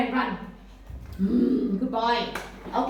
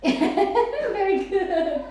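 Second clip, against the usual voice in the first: laughing, high and slow; 8 semitones higher, 4 dB louder, much slower than usual. Real, not acted. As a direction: laugh through the praise. Teacher laughs at child's dance.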